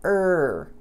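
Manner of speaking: long held "Er" made of R alone; drawn-out R-only "Er"; English R sound. Shown as a demonstration. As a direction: sustain "Er" as one strong American R, no separate vowel. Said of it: "o R".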